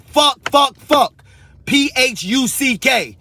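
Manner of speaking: high, loud repeated shouts of fuck; shouted curses. Real, not acted. Ranting at the system, high loud shouted curses.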